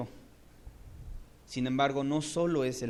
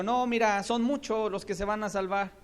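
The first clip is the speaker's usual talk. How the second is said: higher, brighter mock reply; light hypothetical Jesus; 7 semitones higher, 3 dB louder than usual. Preacher imagining a lenient Jesus, voice raised. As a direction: toss it off lightly. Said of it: "Si Jesús le hubiera dicho".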